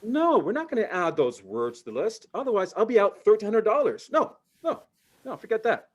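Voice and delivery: high, loud flustered refusal; refusing dad. Retold or imitated. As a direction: shut the kids down in a panic. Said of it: "I'm like".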